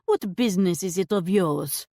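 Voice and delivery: sour put-on French accent; scowling shopkeeper in put-on French; scowling fake French. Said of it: "she scowled".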